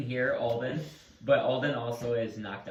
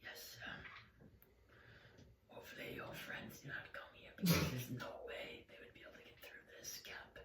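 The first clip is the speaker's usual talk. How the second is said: raspy whisper; 4 semitones higher, 6 dB quieter than usual. Acted. Captor voice continues whispering threats.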